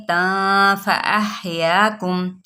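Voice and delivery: slow, drawn-out tajweed chant; Quran recitation. Shown as a demonstration. Teacher models the word for students, slow recitation. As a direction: stretch it like recitation. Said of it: "recites word for students".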